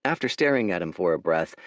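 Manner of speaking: ordinary delivery, nothing marked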